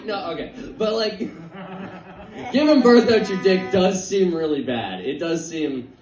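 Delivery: ordinary talk, nothing marked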